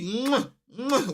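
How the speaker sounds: scarf kisses. Retold or imitated. Fan kissing his Arsenal scarf to tease colleagues.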